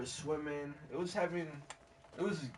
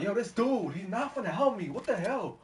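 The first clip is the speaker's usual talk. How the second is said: high-pitched mock outrage; incredulous complaint; 8 semitones higher, 5 dB louder than usual. Swimmer saying he's not gonna help, high indignant disbelief. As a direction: protest in acted disbelief.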